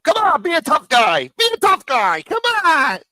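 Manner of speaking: high, loud mock taunt; taunting Dom. Streamer imitates Dom goading the dude, high and loud. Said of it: "Dom meets up with the dude".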